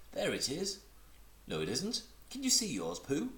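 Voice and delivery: high, eager small kangaroo; little Roo voice. Acted. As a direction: chatter up high like a tiny child.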